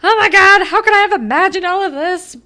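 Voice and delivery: put-on high, loud, rushed teen panic; hammed-up imitation of a girl flipping out; mock freak-out. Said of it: "Jenna's flipping out and is like".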